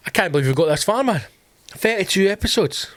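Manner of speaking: ordinary delivery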